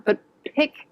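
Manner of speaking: ordinary talk, nothing marked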